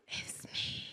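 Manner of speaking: soft, voiceless whisper; whispered self-blame. Acted. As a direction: whisper the admission to yourself. Quiet repeat of the confession.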